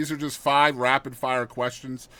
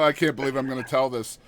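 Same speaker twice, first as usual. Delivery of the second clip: usual speaking voice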